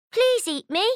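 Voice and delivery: high, loud goat plea; little goat voice. Acted. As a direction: cheerfully offer yourself up. Silly answer option.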